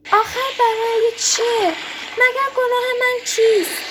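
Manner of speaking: high, loud-edged wailing plea; tearful pleading. A narrator's reading. Fisherman begging the demon for his life, tearfully pleading. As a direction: beg as if crying, pitch way up. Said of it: "با التماس و گریه و زاری گفت".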